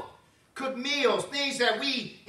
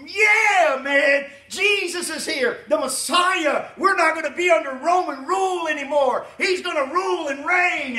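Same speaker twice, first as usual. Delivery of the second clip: excited disciples; 6 semitones higher, 8 dB louder than usual. Retold or imitated. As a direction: shout it like the Messiah has come. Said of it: "they were excited".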